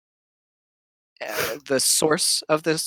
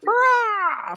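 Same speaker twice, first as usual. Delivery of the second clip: high imitated hawk cry; drawn-out, high-pitched bird call; bird screech. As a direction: screech like a hawk taking flight. Game master voices Krampus flying up.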